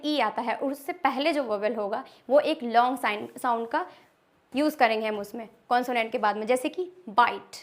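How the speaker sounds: normal talking voice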